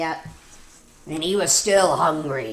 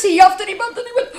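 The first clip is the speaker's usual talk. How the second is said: much higher, louder, racing huff and puff; frantic huffing; 8 dB louder, much faster than usual. Acted. Wolf strains to blow down the brick house, frantic and fast. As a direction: speed up into a breathless frenzy.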